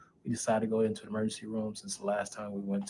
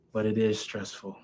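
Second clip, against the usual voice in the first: ordinary talk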